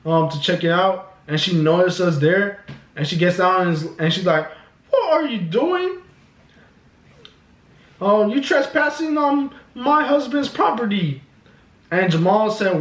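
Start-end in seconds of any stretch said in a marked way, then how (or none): 4.9-11.2 high-pitched mock indignant woman; hammed-up high, officious complaint; imitated Karen. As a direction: challenge trespassers in a put-on Karen voice. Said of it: "some Karen pulls up".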